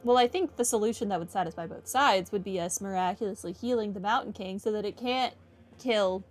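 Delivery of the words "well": usual speaking voice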